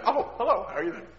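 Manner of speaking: high, sugary switch to hello; sudden sweet greeting. Pretend. Family greeting a visitor after yelling, high and sweet. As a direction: snap into politeness for the guest.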